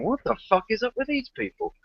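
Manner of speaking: high, incredulous mock outburst; a bemused bystander's jeer; exasperated onlooker. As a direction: play a baffled internet user.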